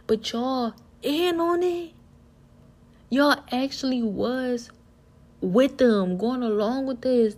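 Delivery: loud, high-pitched indignant confrontation; mad, incredulous. Retold or imitated. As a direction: demand answers from family who betrayed you, drawing words out. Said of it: "I still so mad 'cause I was like".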